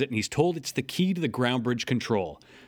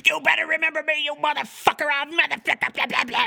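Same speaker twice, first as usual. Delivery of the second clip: mock yell. Miko yelling at Megatron while dragged away. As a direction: scream it like a brat picking a fight.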